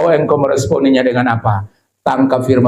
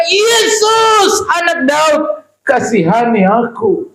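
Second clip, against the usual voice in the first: shouted plea; slower than usual. Acted. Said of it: "dia teriak".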